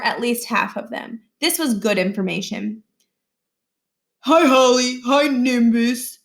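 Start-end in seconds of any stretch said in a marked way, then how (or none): 4.2-6.2 lightly voiced smug bully, louder, slow on the second hi; a hint of a sneering schoolboy; bully greeting. Josh greets Holly and sneers at Miles, lightly smug.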